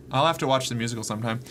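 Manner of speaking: ordinary talk, nothing marked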